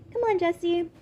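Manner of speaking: high, bright call to a friend; brightly, lifted up; cheerful invitation. A narrator's reading. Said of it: "Emily said brightly".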